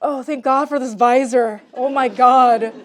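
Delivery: big, loud outburst; loud relief. Real, not acted. Reader thankful for the visor, loud relieved aside. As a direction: burst out of the reading with loud relief.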